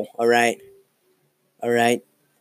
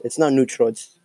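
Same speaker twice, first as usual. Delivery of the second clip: ordinary talk, nothing marked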